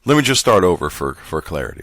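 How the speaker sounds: normal talking voice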